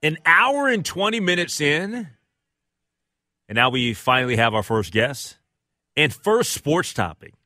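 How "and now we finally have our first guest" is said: ordinary talk, nothing marked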